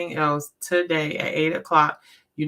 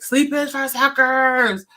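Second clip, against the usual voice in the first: high, loud, slow battle cry; a hammed-up rallying yell; hyped mock shout; 9 semitones higher, 6 dB louder, slower than usual. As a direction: belt it out like a pumped-up motto. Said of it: "doing stuff like".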